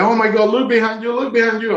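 very high, astonished alert; retold excitement. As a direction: call out high and urgently to turn round. Boatman excitedly spots the dolphin.

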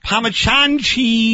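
long, slow "pah-ma-chahn" for Parmesan; mangled Italian pronunciation. Acted. Barbecue man showing how Italy says it, mispronounced. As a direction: stretch Parmesan with a CH and no R. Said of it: "That is not the way they say it in Italy".

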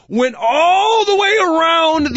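"all" stretched long and high; drawn-out ALL. Real, not acted. Exaggerating how far the line stretched.

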